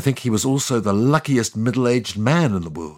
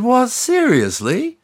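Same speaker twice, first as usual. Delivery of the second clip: slow, much higher disbelieving question; stunned disbelief; incredulous; 12 semitones higher, 4 dB louder, slower than usual. Acted. God reacts to Moses' promise.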